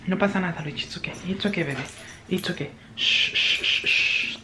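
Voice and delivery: soft murmur sinking into whispers and shushes; gentle soothing. Real, not acted. Telling scared baby it is okay, gentle soothing. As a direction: comfort a frightened little one very softly.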